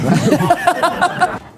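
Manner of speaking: high bursting laugh; burst of laughter. Real, not acted.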